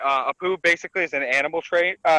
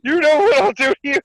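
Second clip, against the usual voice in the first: threatening; 9 semitones higher, 6 dB louder than usual. Retold or imitated. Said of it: "he's like".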